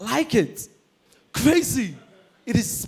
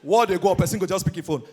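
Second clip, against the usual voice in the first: ordinary delivery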